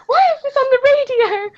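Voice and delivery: high-pitched, mock-alarmed wail; retold frightened panic. Listener alone with spooky radio at night, retold fright. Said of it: "and I was frightened".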